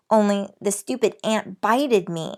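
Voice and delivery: ordinary delivery, nothing marked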